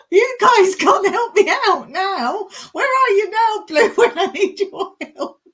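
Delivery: high, loud, laughing appeal; real giggly exasperation; laughing plea. Real, not acted. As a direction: wail for help while cracking up. Mock plea amid laughter.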